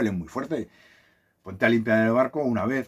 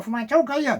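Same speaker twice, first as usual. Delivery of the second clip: lightly mimicked parrot, higher; 13 semitones higher than usual. Parrot hushing the dog all day, lightly mimicked. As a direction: copy the owner like a parrot would. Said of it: "y el loro".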